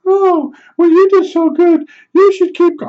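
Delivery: high falsetto, fake sweet praise; neighbor woman voice. Neighbor praising my cleaning, high mock falsetto. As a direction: coo it like a patronizing old lady.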